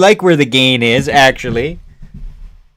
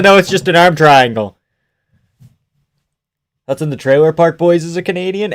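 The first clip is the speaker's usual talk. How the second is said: ordinary delivery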